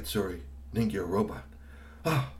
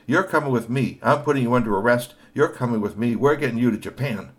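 louder firm command; stern arrest; 4 semitones higher, 9 dB louder than usual. A narrator's reading. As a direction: lay down the law. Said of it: "Kashikoi said".

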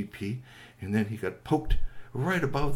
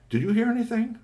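high character voice; 12 semitones higher than usual. Acted. Raccoon Rocky asks Bernie.

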